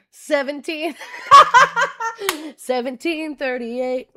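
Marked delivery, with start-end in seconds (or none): 1.0-2.5 loud squealing laugh; cracking up at herself; high burst of laughter. Real, not acted. Laughs at her own mistake.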